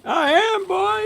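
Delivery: very high, excited holler; high hype yell. Real, not acted. Still fighting the gator.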